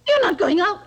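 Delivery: cling to her with your voice; frantic begging. Acted. Begging friend not to leave.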